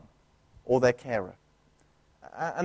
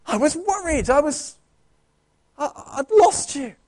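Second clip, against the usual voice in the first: put-on high, near-tearful child voice; small child reunited, voice catching; worried child; 9 semitones higher than usual. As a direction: plead like a lost little kid. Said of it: "had someone say it to you".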